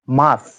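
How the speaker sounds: মার্চ said "maach" with no r; r dropped. Shown as a demonstration. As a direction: model মার্চ without the r. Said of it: "আরটা উচ্চারিত হচ্ছে না". (আর ছাড়া মার্চ)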